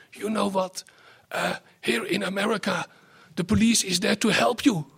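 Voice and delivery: a hint of an old man's voice; lightly voiced old man. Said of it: "an old man says with his old voice".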